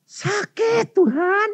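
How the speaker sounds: very high, drawn-out wail; slow, high-pitched mock wail; pained cry. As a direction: imitate mother crying out in pain. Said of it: "Mengapa kamu teriak-teriak?".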